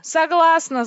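high, very loud acceptance; long, full-voiced shout; yell. A narrator's reading. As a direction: holler it without hesitation. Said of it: "заорала я".

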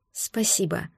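soft, hushed word of thanks; barely voiced, understated gratitude; near-soundless thanks. A narrator's reading. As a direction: let the word out very softly, almost to yourself. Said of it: "прошептала беззвучно".

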